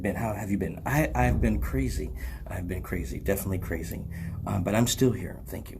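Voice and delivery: low, soft ad-read delivery; commercial voice. Acted. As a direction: keep the infomercial voice going. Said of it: "Why am I still talking like a commercial".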